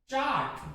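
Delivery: चार्ट said softly with silent r; correct pronunciation. Shown as a demonstration. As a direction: say चार्ट quietly as "chaat". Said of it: "वो नहीं आएगी".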